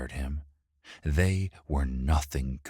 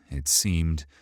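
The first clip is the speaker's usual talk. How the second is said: ordinary delivery, nothing marked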